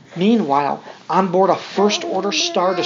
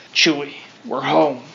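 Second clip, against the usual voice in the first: a hint of a lower Han voice; lightly voiced Han Solo; 5 semitones lower than usual. Parent reading to child, voicing Han greeting Chewie, a touch lower. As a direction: drop the voice a little for Han. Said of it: "Han says to his furry friend".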